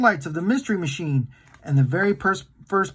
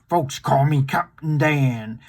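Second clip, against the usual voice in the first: put-on old sailor voice; 3 semitones lower, slower than usual. Old sailor introduces himself.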